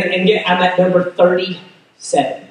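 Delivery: normal talking voice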